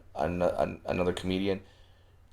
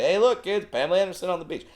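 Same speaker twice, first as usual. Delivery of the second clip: much higher, bright announcing; goofy dad voice; 9 semitones higher, much faster than usual. Retold or imitated. Dad to kids in the car, high and goofy. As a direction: hype it up like a dad pointing out the window. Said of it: "I have to say".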